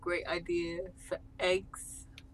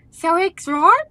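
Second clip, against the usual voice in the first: high, loud mock Kiwi accent; New Zealand accent; 9 semitones higher, 15 dB louder than usual. Reading a friend's egg pitch to co-host, New Zealand accent. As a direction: put on an over-the-top New Zealand accent. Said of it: "do it in your best New Zealand accent".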